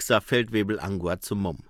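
ordinary delivery

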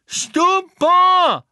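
very high, loud, drawn-out yell; frantic high spell cry; shouted spell. A narrator's reading. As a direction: fling the spell out at full pitch. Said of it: "rief Neville erneut".

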